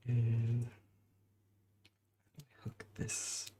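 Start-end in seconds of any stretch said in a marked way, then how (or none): 2.6-3.5 unvoiced whispered instruction. Real, not acted. Assembling a piece, whispering the step. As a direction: breathe it to yourself.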